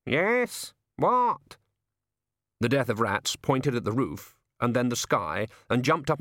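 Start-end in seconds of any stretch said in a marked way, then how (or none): 0.1-1.6 higher, slow, grumbling raven; a put-upon bird having a moan, slowly. Acted. The raven answers.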